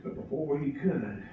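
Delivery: ordinary delivery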